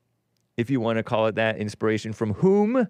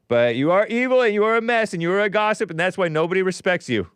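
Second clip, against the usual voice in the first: mock scolding; 7 semitones higher, 3 dB louder, faster than usual. Host berating someone, mock outrage.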